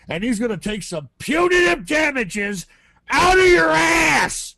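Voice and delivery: threat building to a high shout; angry threat. Real, not acted. Caller threatening the clerk with his lawyer, shouting.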